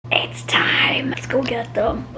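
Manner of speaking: slow, voiceless whisper; hushed whisper. Pretend. Vlogger announcing it is time to get the jars, slow whisper. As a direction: whisper it like a secret mission.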